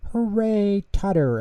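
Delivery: high, bright cheer of a crowd; a family cheering Tutter on; cheering yell. A narrator's reading. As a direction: cheer his name out loud. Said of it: "everyone yelled".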